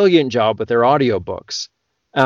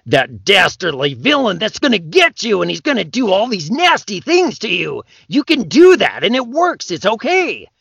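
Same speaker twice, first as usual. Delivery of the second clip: much higher hammed-up dastardly villain; cliche villain voice; 7 semitones higher than usual. Shown as a demonstration. Narrator demoing a cliche villain for game lit. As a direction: ham up the cartoon baddie. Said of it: "some of them can have a cliche voice".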